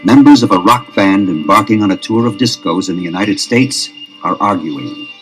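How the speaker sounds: usual speaking voice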